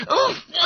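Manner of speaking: sharp high oof as the breath is knocked out; kicked in the stomach; winded gasp. Acted. Schoolboy kicked under the table, sharp winded oof. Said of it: "gasped Bunter".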